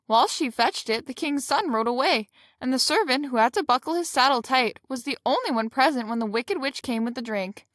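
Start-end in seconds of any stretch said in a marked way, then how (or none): none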